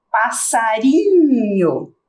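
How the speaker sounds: passarinho slowly, leaning on the final inho; stretching the ending. Shown as a demonstration. Teacher stretches passarinho so pupils hear the end. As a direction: drag passarinho out so inho rings. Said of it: "eu falo".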